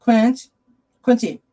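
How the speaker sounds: loud, high call to the cat. Real, not acted. Calling Quincy over from the chair. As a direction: call out to get the cat's attention.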